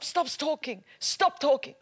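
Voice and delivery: usual speaking voice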